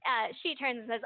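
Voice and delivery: ordinary delivery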